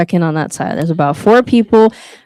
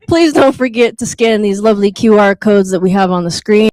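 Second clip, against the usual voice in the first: usual speaking voice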